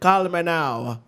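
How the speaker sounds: slow, drawn-out Jamaican hotline voice; Jamaican accent. Retold or imitated. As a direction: stretch it like a late-night psychic ad. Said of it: "she's not Jamaican?".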